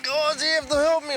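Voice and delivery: high, mock-desperate plea; mimicked pleading. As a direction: imitate a hurt mate begging for help. Injured friend pleading, mimicked.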